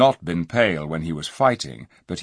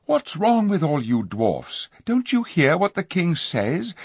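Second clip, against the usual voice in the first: put-on high, pleading girl's voice; eager young girl; 11 semitones higher, 4 dB louder than usual. A narrator's reading. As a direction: coax them, bright and urgent. Jill pleads with dwarfs.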